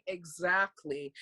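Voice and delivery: low, slow, quiet knowing reply; pointed exactly. Retold or imitated. Answering her friend's shock, low, slow and pointed. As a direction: let it land, low and deliberate.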